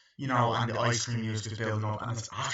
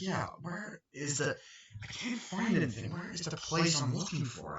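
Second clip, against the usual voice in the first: put-on little girl voice; 6 semitones higher, 3 dB quieter than usual. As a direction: play the lost little daughter. Voicing the young daughter.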